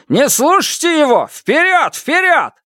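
very high, urgent battle cry; rallying shout. A narrator's reading. Лю Тяньшан urging villagers forward, rallying shout. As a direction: spur the mob on to attack. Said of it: "закричал Лю Тяньшан".

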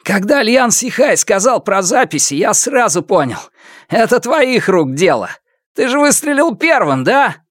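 high-pitched, strained accusation; accusing villain. A narrator's reading. Villain accuses from behind pillar. (обвиняющий голос злодея, высокий)

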